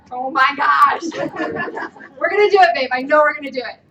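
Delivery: loud, excited cheering on; rally your partner with glee; ecstatic. Retold or imitated. Retold excitement with husband.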